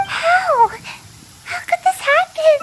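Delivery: high-pitched cry of disbelief; shocked dismay. Acted. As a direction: gasp it out like everything just went wrong. Dramatic dismay after losing.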